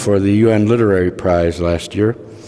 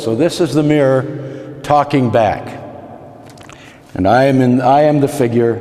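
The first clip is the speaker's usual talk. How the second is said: ordinary delivery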